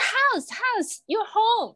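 imitating an Aussie saying house, high and very slow; Australian accent. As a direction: mimic the Australian man stressing the H in "House, house", drawn out. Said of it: "他们house就会那个H音就会特别重". (模仿澳洲口音，把"House"的H音读得很重)

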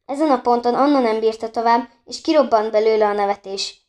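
ordinary delivery

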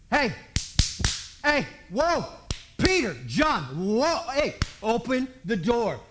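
loud hollered calls through a door; urgent calling out. Acted. Mary trying to get in at the door, loud urgent calls. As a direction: holler to be let in, punching each word.

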